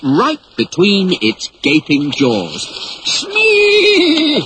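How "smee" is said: much higher, louder long yell. Acted. Hook yelling to Smee from the jaws, panicked. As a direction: bellow the name in panic. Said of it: "yelled Captain Hook".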